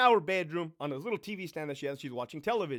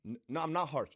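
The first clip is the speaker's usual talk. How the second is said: ordinary delivery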